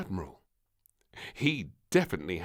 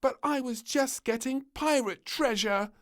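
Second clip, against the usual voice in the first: high, loud, whiny protest; pouting; 7 dB louder than usual. Acted. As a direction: pout it out. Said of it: "pouted Little Princess".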